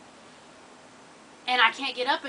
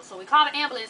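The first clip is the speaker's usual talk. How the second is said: ordinary talk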